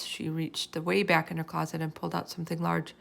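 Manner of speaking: usual speaking voice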